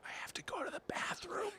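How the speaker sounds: discreet stage whisper; hushed, breath-only announcement. Shown as a demonstration. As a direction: whisper it like a slightly embarrassed confidence. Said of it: "you lower your voice and go".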